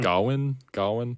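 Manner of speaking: "Gawain" as a questioning GAH-win; trying out pronunciation. Shown as a demonstration. Host unsure of the film's name, trying "Gawain" as GAH-win. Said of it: "they pronounce it".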